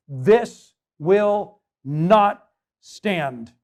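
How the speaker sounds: holy indignation refusing to back down, slow and firm; firm resolve. Acted. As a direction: lay down the line word by word.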